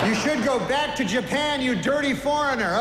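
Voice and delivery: loud, brash stand-up host working the crowd; awards host monologue. Acted. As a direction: play to the room like an awards show host. Stand-up host monologue, louder, announcer.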